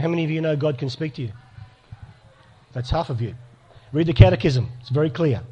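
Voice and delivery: usual speaking voice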